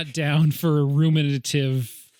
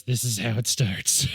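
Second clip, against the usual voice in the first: low, slow ominous line; ominous whisper; 5 semitones lower than usual. Retold or imitated. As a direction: deliver it like a dark omen. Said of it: "one leans over to the other one and goes".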